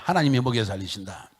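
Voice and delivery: loud preacher's call for the congregation to repeat. Shown as a demonstration. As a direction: shout it back to the congregation, loud. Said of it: "따라합시다".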